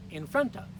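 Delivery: "In front of" with the weight on "front"; second-syllable stress. Shown as a demonstration. Teacher models for learners, stress on "front". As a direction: punch "front", keep "In" and "of" light. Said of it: "Again, second syllable".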